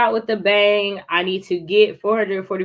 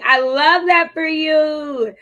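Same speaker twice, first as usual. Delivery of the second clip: high, slow, louder praise; delighted cheer; 8 semitones higher, 6 dB louder, slower than usual. Real, not acted. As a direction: gush over a viewer's plan. Cheering a viewer's news.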